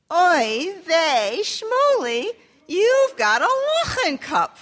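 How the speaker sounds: high, loud, drawn-out "lachen cup"; sing-song despairing refrain. Shown as a demonstration. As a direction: lead the listeners in the mother's wail. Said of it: "You can say that with me".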